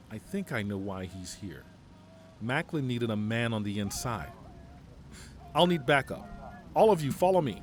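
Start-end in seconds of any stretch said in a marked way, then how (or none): 5.5-7.6 loud, raised order to the squad; rally the soldiers behind you; commanding call. Acted. General calling his soldiers to follow, loud command.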